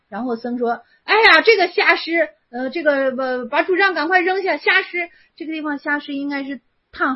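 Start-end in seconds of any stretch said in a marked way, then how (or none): 1.0-5.1 indignant shout. Acted. Monk scolds master in koan.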